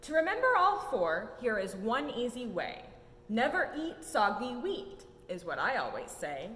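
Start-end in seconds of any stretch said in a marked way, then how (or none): none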